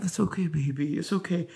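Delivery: soft hushed comfort; soothing. Retold or imitated. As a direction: soothe a crying partner gently. Partner soothing her crying.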